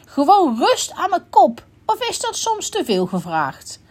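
high-pitched, irritated outburst, mildly shouted; exasperated shout. A narrator's reading. Grumpy unicorn demanding peace from the others, exasperated mild shout. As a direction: start high and forceful, then ease off. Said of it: "schreeuwde de neehoorn".

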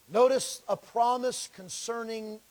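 normal talking voice

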